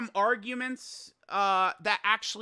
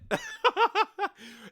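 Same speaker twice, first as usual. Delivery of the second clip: high-pitched laughing; laughter; 13 semitones higher than usual. Real, not acted. Streamer amused by his chat, laughing.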